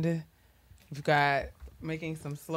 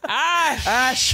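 Ash hit loud and high, fully stressed; punched "Ash"; 8 semitones higher, 7 dB louder than usual. Retold or imitated. Teasing her friend, loudly punching the first syllable. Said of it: "you put so much in ash".

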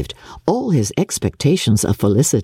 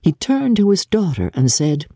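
usual speaking voice

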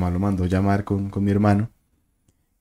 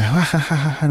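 high imitated cackle, not real laughter; mock eerie laugh; 7 semitones higher than usual. Imitating the possessed girl laughing, mock high cackle. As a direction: mimic the possessed girl's laugh in a raised pitch. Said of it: "Y se reía. Decía".

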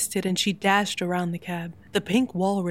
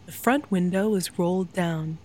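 ordinary delivery

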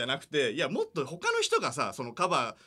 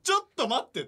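higher, louder double take; wait, what?; surprised inner voice; 11 semitones higher, 5 dB louder than usual. Retold or imitated. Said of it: "と思って". (驚いた心の声)

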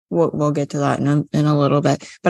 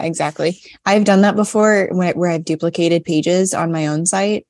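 ordinary talk, nothing marked

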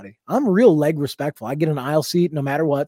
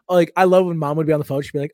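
usual speaking voice

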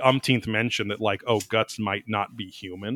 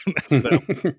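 usual speaking voice

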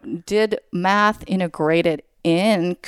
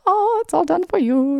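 high, sing-song mock sweetness; doting mom voice; 10 semitones higher than usual. Mom to kids waking to everything made, high mock-doting voice. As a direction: pitch it way up, playfully serving the kids.

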